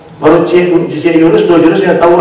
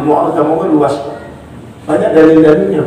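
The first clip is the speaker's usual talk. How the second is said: usual speaking voice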